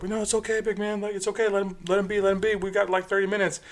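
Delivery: high, urgent mimicked placating; imitating anxious peacekeepers. Bystanders soothing a big man.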